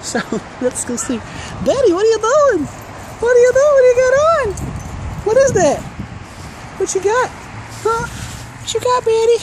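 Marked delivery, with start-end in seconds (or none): 1.7-8.1 dog-talk voice. Real, not acted. Owner quizzing her dog Betty in high baby talk. 8.1-9.4 dog-talk voice. Real, not acted. Owner quizzing her dog Betty in high baby talk.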